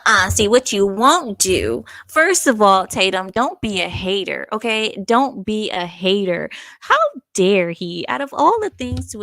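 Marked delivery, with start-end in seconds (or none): 0.1-0.3 laughing protest. Real, not acted. Protesting insult to Spanish. 0.3-6.5 mock scolding. Real, not acted. Scolding Tatum for insulting Spanish.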